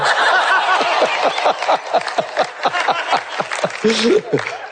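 rolling, gleeful laughter; long high laugh. Real, not acted. Telling the audience the story, long gleeful laughter. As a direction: laugh until it trails off.

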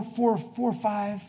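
ordinary talk

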